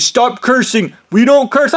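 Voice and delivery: high-pitched imitation of a prim telling-off; mock scolding. Imitating the other man's scolding.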